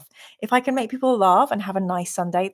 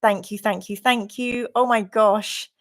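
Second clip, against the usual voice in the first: ordinary talk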